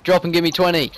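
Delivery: drill sergeant shout. Acted. Barking orders at trainee slave.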